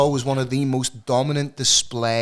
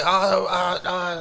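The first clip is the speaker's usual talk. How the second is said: higher, sheepish stammering stranger; imitating caught litterer; 8 semitones higher, much slower than usual. Mimicking the man caught littering, higher sheepish stammer. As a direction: play the caught-out man fumbling. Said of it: "I was like".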